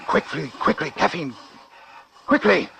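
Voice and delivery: doctor calling urgently for help; urgent orders. Acted. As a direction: bark for the drug as the patient fails. Patient dying on operating table.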